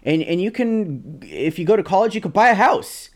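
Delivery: mock the know-it-all boomer's easy advice. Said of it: "they still have that mentality where they're like".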